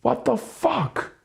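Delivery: high, slow disbelief; slow, high weary outburst; exasperated. Real, not acted. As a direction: drag out the disbelief.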